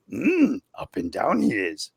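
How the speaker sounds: slow, rising and falling Yoda cadence; slow Yoda voice with up-and-down tune. Shown as a demonstration. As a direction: speak backwards like the little green master. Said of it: "inflections are off".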